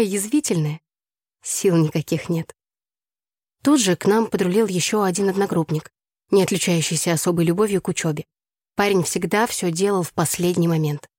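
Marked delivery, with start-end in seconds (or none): none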